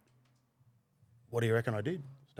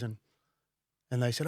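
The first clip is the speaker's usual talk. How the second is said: ordinary delivery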